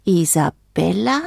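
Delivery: small questioning rise; tentative little bird. A narrator's reading. Bird trying out its new name for the frog, tentative. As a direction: try the word out shyly, rising at the end.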